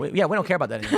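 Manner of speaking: fast, breezy mock shrug; mock dismissive. Anti-war friends brushing him off, mock breezy. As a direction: toss it off like nothing matters. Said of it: "And they were like".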